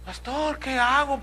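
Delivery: lightly voiced plea to the pastor; pleading churchwoman. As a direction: play the troubled wife begging for advice. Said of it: "correos recibo de mujeres cristianas".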